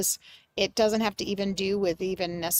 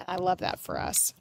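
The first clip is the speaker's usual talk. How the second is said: softer murmured I love that for us; quiet aside; 3 semitones lower, 7 dB quieter than usual. Real, not acted. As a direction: say it half to yourself. Repeats phrase, first take hushed.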